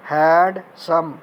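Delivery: "हैंडसम" slowly split as हैंड, सम; syllable breakdown. Shown as a demonstration. Teacher showing students two syllables, "हैंडसम" slowly divided into हैंड and सम. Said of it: "दो, हैंड और सम".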